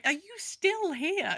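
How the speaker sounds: high, slow, mock-quizzical cat voice; voicing the cats. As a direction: ask it as a bemused cat would. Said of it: "our cats will circulate as if to say".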